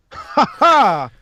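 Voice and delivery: high, theatrical "ha ha"; hearty in-character laugh. Acted. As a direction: burst out in a high villainous laugh. Game master laughing as Alastor.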